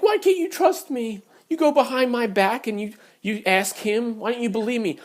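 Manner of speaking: high-pitched mock-indignant accusation; arguing, yelling. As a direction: argue back in her aggrieved voice. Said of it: "We start arguing, yelling".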